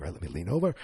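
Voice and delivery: a casual low grunt of a line; Vin Diesel impression. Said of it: "then he's like".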